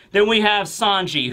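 usual speaking voice

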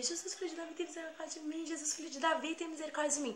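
high, unbroken wailing chant; crazed praying. Retold or imitated. Girl acting crazy before robber, frantic prayer. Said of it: "Dei uma de louca mesmo".

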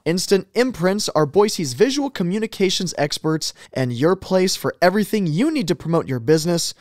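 upbeat sponsor read. Acted. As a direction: read it like a radio ad. Reads sponsor ads.

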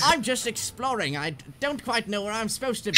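squeaky-eager, unsure nerd. Acted. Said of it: "Nerd voice".

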